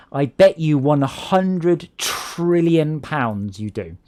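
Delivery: usual speaking voice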